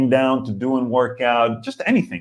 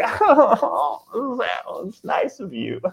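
high, bashful giggly thanks; hammed-up flattered delight; flattered giggle; 10 semitones higher, much slower than usual. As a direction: giggle through it as if coyly lapping up praise. Acting flattered by compliments.

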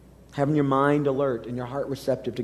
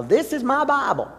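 higher, smiling TV preacher voice; imitating Joel Osteen; 9 semitones higher than usual. Pastor quotes Osteen's mantra with a smiling lift. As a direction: sell it with a big grin. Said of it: "with a big smile".

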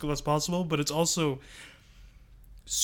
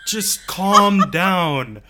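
drawn-out, louder plea; slow pleading; 2 semitones higher, 7 dB louder, much slower than usual. Real, not acted. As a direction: stretch each word, begging it to stop. Pleading at the movie.

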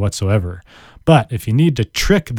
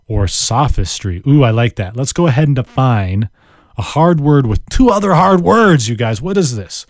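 usual speaking voice

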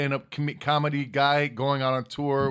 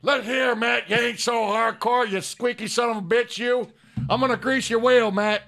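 wrestling promo; 6 semitones higher, 3 dB louder than usual. Shown as a demonstration. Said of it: "in your best Stone Cold voice".

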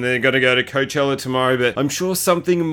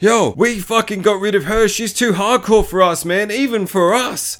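higher put-on voice of a scared wannabe; cult poser dude; 7 semitones higher than usual. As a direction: play the cult guy backing off. Cult shop guy voiced.

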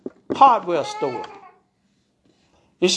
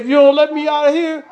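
loud, raised-pitch threat; angry threat; 5 semitones higher, 7 dB louder than usual. A narrator's reading. Tied-up husband to his wife, angry threat.

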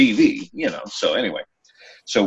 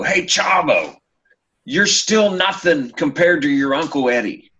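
high yelled heckle at the wrestler; heckling yell; 5 semitones higher than usual. Retold or imitated. Fan yelling a taunt at Chavo from the seats. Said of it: "I yell".

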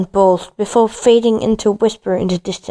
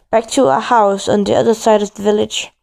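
ordinary delivery

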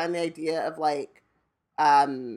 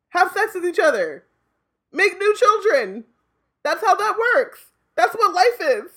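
exasperated mock lecture; 11 semitones higher, 5 dB louder than usual. Mocking the clones' view.

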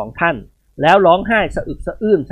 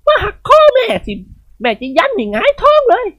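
daring the spirits. Acted. Khun Ying Wad taunting the snake's spirit, high and fierce. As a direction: dare the snake's guardian spirit to come fight. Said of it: "คุณหญิงวาดแผดเสียงลั่น".

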